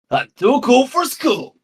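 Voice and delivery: high, slow cocky cartoon hedgehog; mock Sonic catchphrase, drawn out; Sonic quote. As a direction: strut it out like a too-cool cartoon hero. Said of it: "Sonic the Hedgehog has said".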